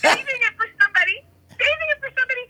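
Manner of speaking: very high mimicking voice; mock the lady, high and insistent; squeaky put-on protest; parking spot lady. Lady guarding parking spot, imitated.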